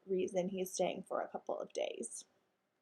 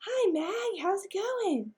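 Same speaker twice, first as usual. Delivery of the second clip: cheery greeting; 10 semitones higher, 5 dB louder, slower than usual. Acted. Hank greets Mag cheerfully.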